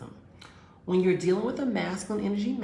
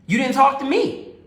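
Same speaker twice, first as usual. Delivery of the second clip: loud, higher hurt accusation; accusing boyfriend; 8 semitones higher, 11 dB louder than usual. Retold or imitated. Boyfriend accusing her of ignoring him, loud and hurt. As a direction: throw the blame at her, raised.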